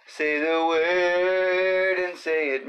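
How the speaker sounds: high, drawn-out delivery; drawn-out catchphrase. Pretend. Opening the show with a catchphrase, drawn out high. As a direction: stretch it out slowly on a high held note.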